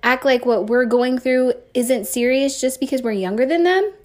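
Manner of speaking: ordinary delivery, nothing marked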